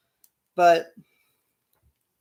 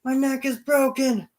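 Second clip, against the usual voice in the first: higher, louder hammed-up cry of a broken neck; fake injury shout; 7 semitones higher, 4 dB louder than usual. Miz faking a broken neck, high mock shout. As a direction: overplay the injury for the crowd.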